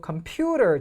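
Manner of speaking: 컴퓨터 with the middle syllable 퓨 strong; stress on 퓨. Shown as a demonstration. Teacher lists possible stress places, stress on 퓨. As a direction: punch 퓨, keep 컴 and 터 light. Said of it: "세 군데가 있다는 거예요".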